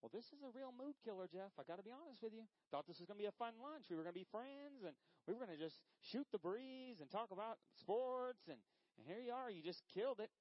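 mock complaint. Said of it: "I said".

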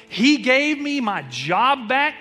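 loud, high, building preacher's cry; preaching at full force. Acted. Pastor crying out to the congregation, loud and high. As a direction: lift the church with every word.

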